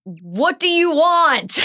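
drawn-out movie-quote yell, high and loud; Noah. Acted. Quoting a favorite Notebook line to the co-host, hammed-up yell. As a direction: yell it slowly, in mock movie anguish. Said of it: "when he yells".

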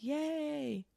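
soft, high fake little cheer; a high, quiet sarcastic yay and a stunned what; mock cheer. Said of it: "look at another woman fail and be like".

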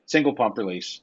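ordinary talk, nothing marked

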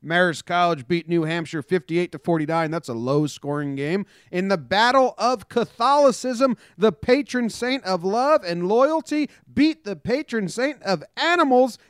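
high, fast helium news read; sped-up sports update read. Acted.

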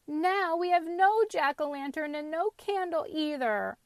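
put-on high-pitched childish lament; mourning. A narrator's reading. Little girl without her jack-o'-lantern, high lament. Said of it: "she mourned".

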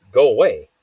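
loud, sudden brush-off; irritated dismissal. Acted. Man shooing away a yapping woman, irritated. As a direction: send them off sharply and loudly. Acted dismissal of pestering person.

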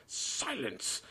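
high, strained cry for silence; strained, angry command at moderate volume; mock yell. A narrator's reading. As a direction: cut the boy off with a held-back yell. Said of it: "yelled Uncle Vernon".

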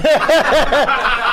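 real, loud burst of laughter; hearty laughing outburst. Real, not acted. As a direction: laugh hard at the memory. Laughing at memory.

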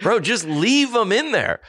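high and slow, stretched out; high drawn-out plea. Acted. Repeats line in high voice.

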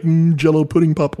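second try at a lightly voiced Cosby; Bill Cosby impression. Host retries the Cosby voice, light imitation. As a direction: hum into a mock pudding pop line. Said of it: "he's like".

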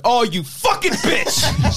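high, drawn-out mock indignation; mock outrage. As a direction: stretch it out high, as if outwitted. Acted outburst at the mom's trick.

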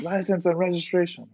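high-pitched mock police order; cop's demand. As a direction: imitate an officer demanding papers.

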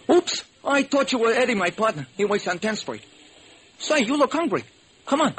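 high, friendly street hustler; street beggar voice. Acted. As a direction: caught out, then kindly. Blind beggar caught out.

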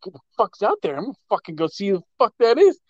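fast, high, ready to fight; fired-up. Retold or imitated. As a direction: charge out to confront someone. Ready to confront the biker.

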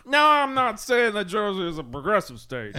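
put-on pompous Kentucky lawyer accent. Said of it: "pompous guy".

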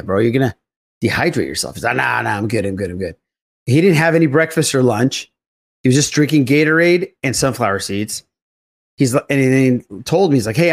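ordinary delivery

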